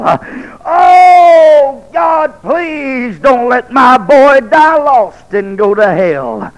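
a retold mother's desperate shouted plea; wailing cry. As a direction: cry out to God for a dying son. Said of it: "I heard somebody crying".

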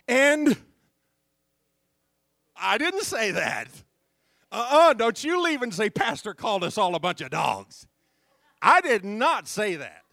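loud, high, ringing sermon delivery; full-force preaching. Acted. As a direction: preach it hard to the whole room.

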